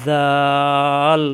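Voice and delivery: জাল stretched very slowly, tongue on teeth; slow teaching demo of জাল; drawn-out soft zal. Shown as a demonstration. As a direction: hold the soft sound in জাল for a long time. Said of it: "নরম করে উচ্চারণ করতে হয়".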